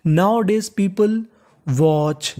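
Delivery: drawn-out Nowadays people; teacher's slow read-aloud; slow model reading. Shown as a demonstration. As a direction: read it out slowly for students. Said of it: "teacher reads phrase slowly".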